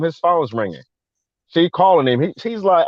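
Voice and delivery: ordinary talk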